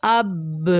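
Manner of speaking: اب with the b bouncing back out; qalqala bounce. Shown as a demonstration. Teacher shows how the sound springs back: bounced b. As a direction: let the b of اب rebound. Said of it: "سب کی آواز واپس پلٹ رہی ہے".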